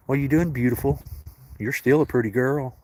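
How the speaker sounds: affectionate high coo at a pretty cat; pet talk. Real, not acted. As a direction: praise the cat in a lifted sing-song. Cooing to a cat.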